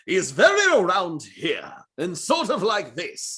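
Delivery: big, slow booming hero voice; bombastic hero voice. Shown as a demonstration. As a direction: go big like a player character hero. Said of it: "my voice for that is".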